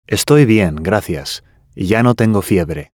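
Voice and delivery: ordinary talk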